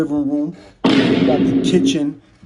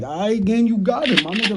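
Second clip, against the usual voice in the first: high, placating; easing the gunman down; nervous surrender; 7 semitones higher than usual. Retold or imitated. To the gunman, high and nervous, giving in.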